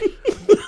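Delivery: a real laugh after the insult; high laugh. Real, not acted. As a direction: burst of high cackling laughter.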